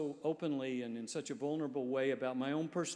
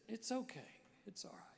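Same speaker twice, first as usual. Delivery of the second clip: reassuring; 8 semitones higher, 9 dB quieter than usual. Retold or imitated. Homeless man reassures pastor.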